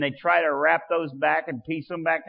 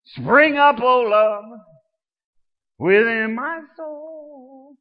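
high, loud falsetto cry to God; soaring falsetto prayer; 7 semitones higher, 11 dB louder than usual. Acted. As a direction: lift the prayer up into a ringing falsetto. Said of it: "I don't know what happened to my falsetto voice".

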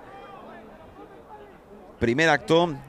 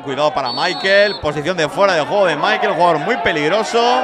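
rising play-by-play alarm as the chance builds; urgent call of a dangerous play; excited commentary; 4 semitones higher, 6 dB louder than usual. Real, not acted. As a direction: voice climbing higher and louder over the attack. Live commentary, dangerous attack.

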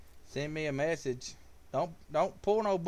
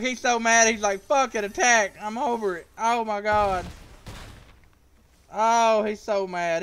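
loud, raised, crowing voice; excited gloating; 6 semitones higher, 7 dB louder than usual. Real, not acted. Streamer to viewers about beaten opponent, excited gloating. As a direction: crow over the rival's misfortune.

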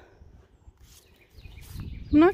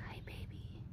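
soft whispered hello; 21 dB quieter than usual. Real, not acted. Hushed greeting to the baby.

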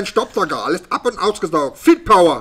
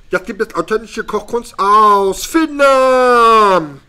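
slow, higher showman announcement; announcer voice; 5 semitones higher, 4 dB louder, slower than usual. Pretend. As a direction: present it like a TV host. Announcing the food show.

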